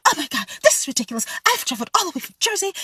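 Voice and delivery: high, fast, frantic complaining lady; frantic customer. Retold or imitated. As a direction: whine it like a panicked guest. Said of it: "she immediately gets frantic".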